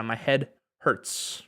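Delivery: My head hurts said at half speed, each word clear; slow demo. Shown as a demonstration. Teacher modelling My head hurts slowly for Spanish speakers. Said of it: "Me duele la cabeza".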